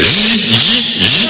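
imitated electric whirring; electric motor buzz. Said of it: "movimientos eléctricos".

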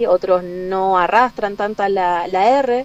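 ordinary talk

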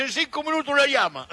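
high, called-out phone message; shouted message. Retold or imitated. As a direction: imitate a shopkeeper hollering news to a neighbour. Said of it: "el gallego decía".

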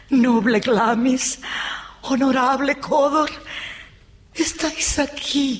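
high, elated welcome; rapturous greeting. Acted. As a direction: greet your husband high and swept away. Rapturous greeting of returning husband. (saludo extasiado, voz aguda)

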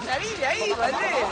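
playful call to a co-host to get out; mock warning shout. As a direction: retell the warning as a playful yell. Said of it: "Yo le dije".

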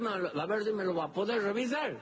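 voz de viejo paisano, más aguda; paisano quejoso. Acted. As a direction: pide ayuda con queja suave. Florentín habla, dolorido. (Florentín enfermo le pide revisión con voz aguda)

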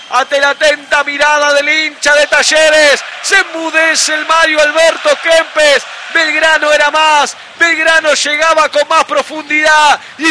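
shouted, high-pitched football goal call with long stretched names. Real, not acted. Radio commentator announcing Belgrano's goal to listeners, full-force goal call. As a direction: belt out the goal and keep the pitch up to the last name.

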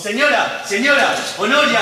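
high urgent call for help; llamando. Acted. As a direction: call someone from another room. Calls out for help. (llamada aguda y urgente)

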